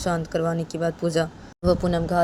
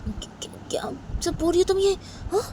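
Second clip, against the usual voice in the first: shocked disbelief; 9 semitones higher than usual. Acted. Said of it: "वो लड़का बोलता है".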